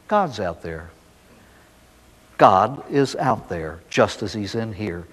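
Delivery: low, soothing mother's explanation, lightly acted; gentle reassurance. Mother explaining the dark to her sobbing son, gently reassuring.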